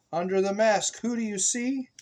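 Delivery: rising, questioning refrain; sing-song refrain. A narrator's reading. Asking the child the book's refrain, sing-song.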